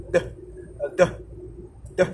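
short "で" as English d, tongue released; isolated D sound. Shown as a demonstration. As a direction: separate little d bursts for "で". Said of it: "これがDの発音なんですけど". (英語のD単独の破裂音で短く「で」)

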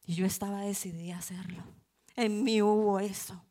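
ordinary talk

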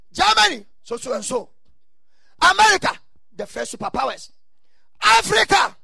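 loud rising preacher calls; preaching. Real, not acted. Preaching at full force, listing nations.

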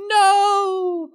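a hammed-up scream as he drops; cartoon falling scream. Villain falling through a trap door, hammed-up cry. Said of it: "And he goes".

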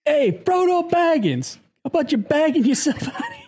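high put-on voice breaking into laughter; Gandolfini as Gandalf. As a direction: heckle a hobbit in a hammed-up mobster voice. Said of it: "done a James Gandolf Feeney bit".

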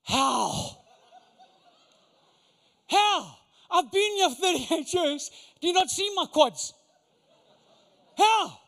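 exasperated how. Retold or imitated. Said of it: "what I would have said to Jesus".

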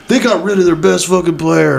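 high, whiny-mad imitation of a fan; retold indignant fan. Customer protesting to host about the trade, mock indignant. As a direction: act out a fan's protest.

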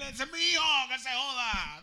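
high, loud father shouting back; hammed-up dad telling the crowd off; defiant father. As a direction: bark back that it's his son. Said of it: "Y él".

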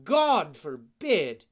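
loud, slow, indignant fox; mock-offended fox. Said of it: "exclaimed the fox, as if offended".

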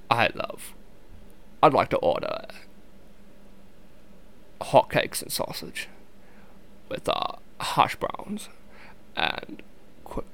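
soft, halting British accent; shaky attempt at a British film-star accent; Michael Caine. Shown as a demonstration. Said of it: "I tried to do a Michael Caine impersonation".

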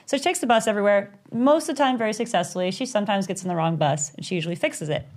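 plain reading voice

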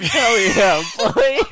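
loud, high hell yeah; excited cheer. Real, not acted. Cheering about getting high.

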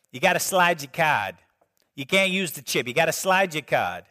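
imitated Boston checkout clerk; thick Boston accent, dropping the r's. Cashier telling out-of-town customers to slide the card, Boston accent. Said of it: "I'm from Boston".

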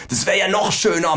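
indignant kangaroo grumble; grumbling. Acted. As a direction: grouse at the idea. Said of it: "meckert das Känguru".

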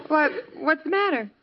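a husband still laughing as he asks; laughing question. Acted. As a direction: giggle through the question, amused. Husband laughing at wife's remark.

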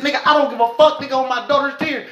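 high, heated retold oath; retold heated swear. Squaring off with Lee, high heated retelling. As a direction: fire it back, pitched high.

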